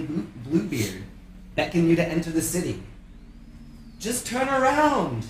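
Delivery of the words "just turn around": high, slow luring call. Acted. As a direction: call them back sweetly. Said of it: "they call".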